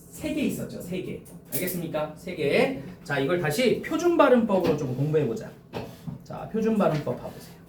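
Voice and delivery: ordinary delivery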